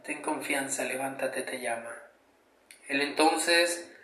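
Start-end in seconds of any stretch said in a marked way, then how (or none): 0.0-2.0 much softer and lower, gently coaxing; softly encouraging. Acted. Crowd urging the blind beggar to rise, softly and gently. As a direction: coax a blind man to get up. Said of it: "le dicen".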